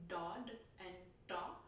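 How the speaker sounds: "dod and da" with tongue curled back on each D; Indian-style retroflex D in "dod and da". Shown as a demonstration. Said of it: "as retroflex consonants".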